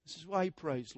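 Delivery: normal talking voice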